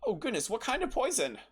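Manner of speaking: high, flustered shopkeeper; alarmed. Acted. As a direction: fret over the news. Shopkeeper alarmed by poison news.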